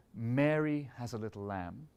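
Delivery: "Mary" leaned on, rest released, slow; stress on MARY. Shown as a demonstration. Conductor demonstrating emphasis and release in a bar. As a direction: slowly lean into "Mary", then release. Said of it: "there's an emphasis in the bar".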